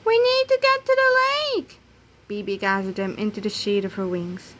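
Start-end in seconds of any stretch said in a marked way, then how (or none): 0.1-1.8 high, loud chick voices calling. Acted. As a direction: call out to him in little voices. Chicks urging Toto.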